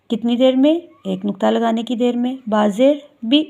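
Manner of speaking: normal talking voice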